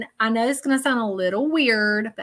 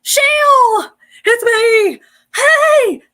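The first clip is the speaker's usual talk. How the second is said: loud, much higher gleeful calls; excited calling out; 11 dB louder, much slower than usual. Retold or imitated. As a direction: call out her name like a happy friend bursting in. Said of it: "She says".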